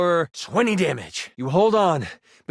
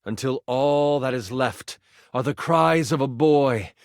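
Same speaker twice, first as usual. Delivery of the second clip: ordinary delivery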